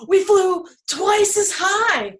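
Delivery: high, slow, loud flourish; excited storytelling. A narrator's reading. Storyteller offering children the second choice, excited. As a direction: stretch it high and loud with wonder.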